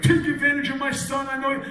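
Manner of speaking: lightly voiced father warning the label; Brooklyn dad. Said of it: "he could be like".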